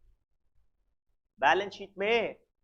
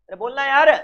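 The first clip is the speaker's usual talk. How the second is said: urging louder; 4 semitones higher, 6 dB louder than usual. Real, not acted. Teacher urging students to answer.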